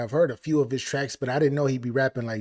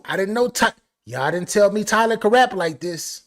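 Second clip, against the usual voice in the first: ordinary talk, nothing marked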